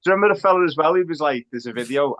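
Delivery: ordinary talk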